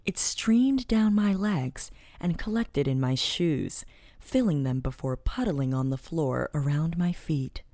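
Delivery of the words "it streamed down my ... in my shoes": ordinary delivery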